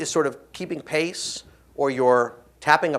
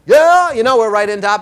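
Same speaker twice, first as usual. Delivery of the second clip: big, high yell at close range; umpire shouting back; 5 semitones higher, 10 dB louder than usual. Acted. An umpire yelling back at someone in his face. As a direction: bellow it nose to nose.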